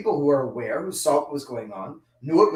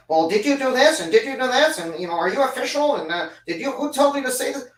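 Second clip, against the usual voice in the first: pushy prying questions, pitched up and louder; mock nosy interrogation; 7 semitones higher, 4 dB louder than usual. Rabbi mimics a meddler policing his observance, prying tone. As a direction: needle them like a busybody checking up on you. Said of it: "I'm not here to tell you".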